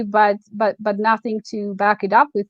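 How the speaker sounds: normal talking voice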